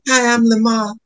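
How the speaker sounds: high-pitched character impression; Lamar. Imitates friend's film character.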